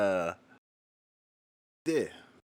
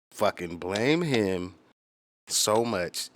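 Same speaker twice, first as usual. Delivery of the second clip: louder, dragged out slowly; emphatic; 3 semitones higher, 7 dB louder, much slower than usual. Real, not acted. Blaming [name] for his own confidence, loud and slow. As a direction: lean on every word, slow and loud.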